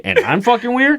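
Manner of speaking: mock indignant. Son retells confronting dad.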